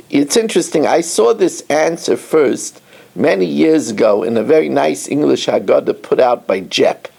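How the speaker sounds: ordinary talk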